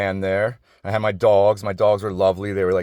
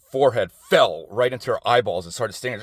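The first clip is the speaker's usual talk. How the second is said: normal talking voice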